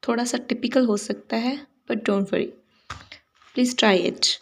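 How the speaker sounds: ordinary talk, nothing marked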